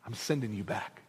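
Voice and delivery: quiet dramatic reveal; hushed. Acted. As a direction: drop to a near hush. Hushed punchline.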